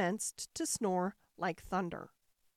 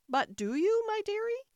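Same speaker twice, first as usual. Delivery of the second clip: wife voice; 13 semitones higher, 4 dB louder than usual. A narrator's reading. Wife coaxes the ogre.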